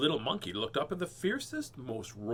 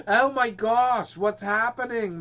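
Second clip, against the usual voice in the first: slow, loud, high-pitched panicked animal; panicked wildebeest; 10 semitones higher, 10 dB louder, slower than usual. Pretend. Reading to kids, voicing wildebeests scared by the tiger. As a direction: voice a startled wildebeest in a drawn-out squeal. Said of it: "Look at the looks on the faces".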